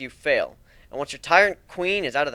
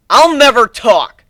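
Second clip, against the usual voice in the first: loud, high, slow defiant yell; defiant cry; 10 semitones higher, 15 dB louder, slower than usual. Acted. Captured changeling defying the griffin, shouting. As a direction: spit your refusal at your captor.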